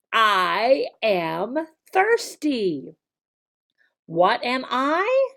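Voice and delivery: each word drawn out and clear; slow classroom drill voice. Shown as a demonstration. Teacher drilling he is thirsty with a child, slow. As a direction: model it slowly like a kids' teacher.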